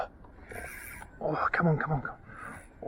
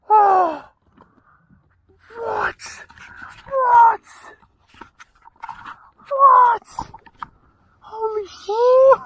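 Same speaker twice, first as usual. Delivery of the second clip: much higher and louder, breaking into a cry; shocked shrieks; 12 dB louder, much slower than usual. Real, not acted. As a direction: yell in disbelief. Disbelief shouts over the fish.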